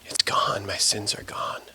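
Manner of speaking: low, hushed relief; barely voiced, whispered release; awed whisper. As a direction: breathe it out softly as the burden lifts. Acts thief's relieved last words.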